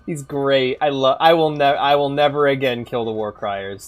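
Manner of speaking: ordinary delivery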